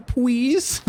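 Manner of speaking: high, drawn-out "pwease"; paper boy. Acted. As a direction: say "please" as "pwees" like a little boy. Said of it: "Talk to the paper boy".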